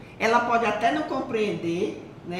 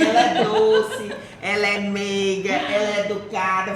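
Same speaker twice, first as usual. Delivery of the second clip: playful insistence; 6 dB louder than usual. Real, not acted. Friend teasingly insisting she is sweet.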